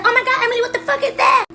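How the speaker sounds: high shrieking little sister; shocked sister. Retold or imitated. Sister sees poop, high shocked shriek. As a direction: squeal it like a grossed-out sibling. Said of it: "she's like".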